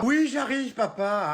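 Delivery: high, drawn-out holler; annoyed call back. Acted. Daughter interrupted by dad, calling back in annoyance. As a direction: call back as if badly disturbed.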